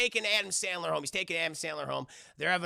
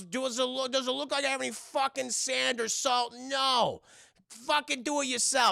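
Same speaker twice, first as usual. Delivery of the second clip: higher, irritated sarcasm; 6 semitones higher than usual. Acted. Davey refusing to help Whitey, irritated retort. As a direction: bite back, fed up, pitched up.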